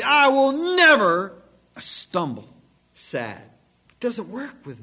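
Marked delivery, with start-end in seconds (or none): 0.0-2.5 "never" punched much louder and higher, "stumble" dropped; stress on never. Shown as a demonstration. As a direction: hit "never" hard, veins out.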